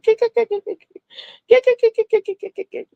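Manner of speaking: high squeaky imitated giggle, kick kick kick; high-pitched mock giggle; giggling laugh imitation. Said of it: "She's like".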